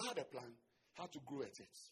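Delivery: ordinary delivery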